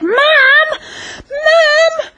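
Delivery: put-on high, loud, wailing child tattling to mom; mock crying kid running to her mother; whiny little girl. Little girl tattling to her mom, whining and half-crying. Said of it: "And she's like".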